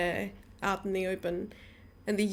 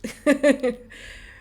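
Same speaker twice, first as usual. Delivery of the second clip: loud, higher-pitched laugh; 5 semitones higher, 8 dB louder than usual. Real, not acted. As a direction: burst out laughing. Amused at own excuse.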